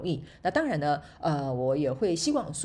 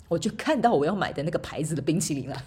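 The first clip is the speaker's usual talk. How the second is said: ordinary talk, nothing marked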